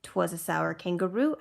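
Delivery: ordinary delivery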